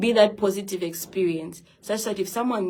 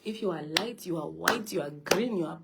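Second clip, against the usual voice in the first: uplifting preaching. Acted. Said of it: "preaching to viewers".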